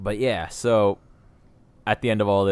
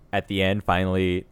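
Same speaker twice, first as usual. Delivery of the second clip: ordinary talk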